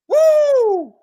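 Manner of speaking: high loud whoop of joy; excited whoop. Real, not acted. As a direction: let out a big cheer. Cheering the play.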